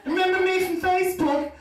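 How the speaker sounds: high, loud shrieking greeting; excited woman shrieking hello across a room; loud shout. Retold or imitated. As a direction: yell it across the restaurant at him. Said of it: "she lets out the biggest shout".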